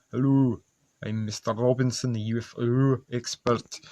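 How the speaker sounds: a lightly put-on Scottish accent, hammed up; terrible Scottish accent. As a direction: introduce yourself as a Scottish expert in a bad Scottish accent. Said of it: "That's my terrible Scottish accent".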